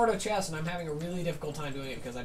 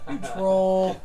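laughing louder; 3 semitones higher, 9 dB louder than usual. Real, not acted. Teasing friend after a prank.

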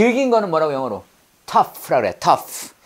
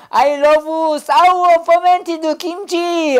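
mock Chungcheong dialect English, slow and much higher; Chungcheong-accented English; 10 semitones higher, 4 dB louder, much slower than usual. Teacher jokes his English has a Chungcheong accent. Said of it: "충청도 English예요".

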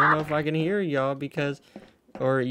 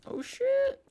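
squeaky surprise. Real, not acted. Sudden alarm.

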